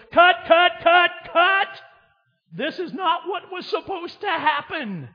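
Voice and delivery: director yelling cut. Retold or imitated. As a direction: shout cut like a frantic director on set. Jesus as film director.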